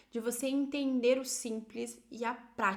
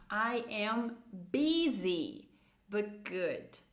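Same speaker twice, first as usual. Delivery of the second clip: very slow, "busy" drawn out; slow model phrase; 2 semitones lower, much slower than usual. Shown as a demonstration. Teacher models an answer for busy women, very slowly. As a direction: stretch each word for learners. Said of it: "Vamos lá".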